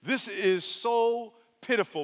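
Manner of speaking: ordinary talk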